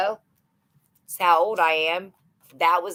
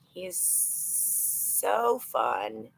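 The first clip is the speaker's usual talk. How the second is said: so drawn out slow and soft; slow, soft gush; 6 semitones lower, 7 dB quieter, much slower than usual. Real, not acted. Mom gushing softly about her seven-year-old, slow. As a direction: linger on each word, fond and quiet.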